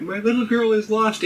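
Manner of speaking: high fretful housewife voice; 1950s housewife. Acted. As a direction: play a worried housewife, pitched high. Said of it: "in the voice of a housewife".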